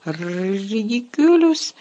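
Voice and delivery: high, slow, frightened squeak. Acted. Neville facing Snape, squeaking the spell in fright. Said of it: "писнув Невіл".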